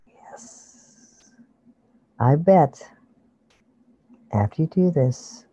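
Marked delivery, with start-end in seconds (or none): none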